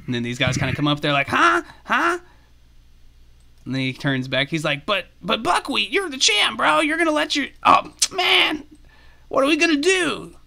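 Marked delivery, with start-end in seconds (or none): 1.3-2.2 high squeaky questioning yelps; puzzled squirrels. Acted. Squirrels coming up confused, high questioning squeak. 4.9-10.4 high squeaky pleading voice; dismayed squirrel. Acted. Squirrel pleading with fleeing champion Buckwheat, high and dismayed.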